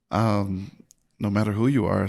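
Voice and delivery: usual speaking voice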